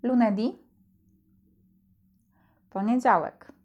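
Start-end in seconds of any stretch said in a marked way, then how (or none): 0.0-0.6 Lunedì said loo-neh-DEE, stress on the final ì; Lunedì with the stress landing on the last vowel; Italian pronunciation. Shown as a demonstration. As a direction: clear teacher model of Lunedì for repeating. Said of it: "Staraj się powtarzać po mnie".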